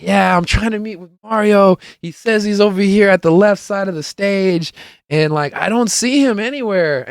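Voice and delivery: high-pitched retold complaint; imitated searching friend. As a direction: pitch it up as the friend hunting around. Acting friend searching for him.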